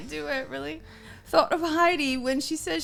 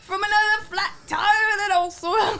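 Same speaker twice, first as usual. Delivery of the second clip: sing-song Irish through giggles; mock Irish accent; 6 semitones higher, 3 dB louder than usual. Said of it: "I can't do an Irish accent".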